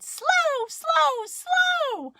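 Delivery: put-on very high, loud little-kid squeal; toddler voice. Little sister learning words, high toddler pipe. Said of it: "And Maggers said".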